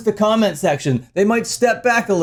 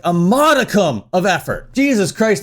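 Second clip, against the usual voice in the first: normal talking voice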